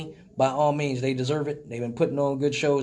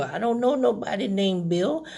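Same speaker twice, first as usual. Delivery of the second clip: a higher voice, playing a dotty old lady; confused old lady; 3 semitones higher than usual. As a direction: pick up the phone like a muddled grandma. Old lady misunderstanding bill.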